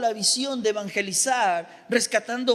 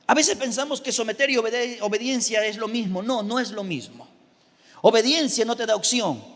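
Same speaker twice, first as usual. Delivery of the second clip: ordinary talk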